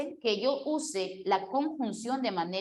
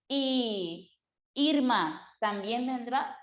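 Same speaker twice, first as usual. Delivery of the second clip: slow "Y", a break, then "Irma"; small pause; slower than usual. Shown as a demonstration. Teacher gives another example, pause between "Y" and "Irma". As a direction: hold "Y" and pause before "Irma". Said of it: "hay una pequeña pausa".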